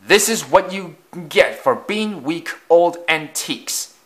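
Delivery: huffy, self-righteous put-down; indignant. A narrator's reading. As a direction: scold them in an indignant, lifted voice. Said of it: "Afton just sniffed indignantly".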